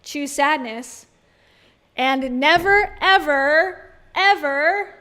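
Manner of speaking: loud, slow mock proclamation; mock dramatic. Hammed-up decree to podcast listeners, loud and slow. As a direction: boom it out like a trailer voice.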